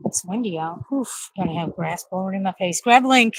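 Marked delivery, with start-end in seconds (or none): 2.8-3.3 loud, high call across the yard. Real, not acted. Mom calling her son from outside: loud call. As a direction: yell the names out.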